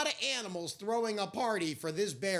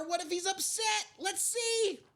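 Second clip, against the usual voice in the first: higher, quick worried whisper-like voice; nervous animals; 12 semitones higher than usual. Acted. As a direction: fret about the bear. Animals fear waking bear.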